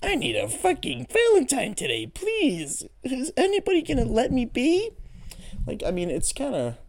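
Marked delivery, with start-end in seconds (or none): 0.0-4.9 high, whiny plea for a Valentine; mock lovelorn whimper; mock desperate single. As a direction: whine like a lonely person begging for a date. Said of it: "You're like".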